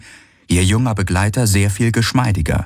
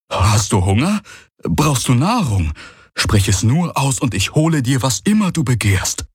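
put-on higher, keen young voice; eager servant; 7 semitones higher than usual. A narrator's reading. Young villager offering the exalted guest food, eager. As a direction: offer anything, keen to please.

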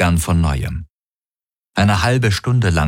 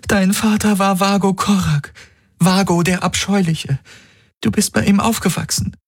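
higher, urgent voice pleading with him to remember; a woman begging him to remember her; Kirai, desperate; 14 semitones higher than usual. A narrator's reading. Kirai tells his past.

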